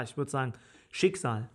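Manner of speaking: normal talking voice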